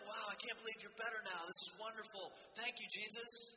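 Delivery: high, loud, overjoyed townsfolk; excited crowd. Retold or imitated. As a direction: gush like a thrilled neighbour. Crowd celebrating the healed man.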